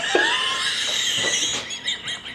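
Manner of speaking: squealing high laugh; shrieking with laughter; high laughter fit. Real, not acted. Cracking up.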